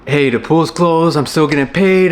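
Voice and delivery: mildly excited retold news to dad. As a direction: lift the pitch, happy to share news. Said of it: "I was excited".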